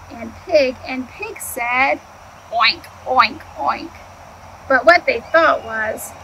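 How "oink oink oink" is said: a lightly voiced oinking; pig oinks. The pigs oinking at Duck. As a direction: grunt it out like pigs.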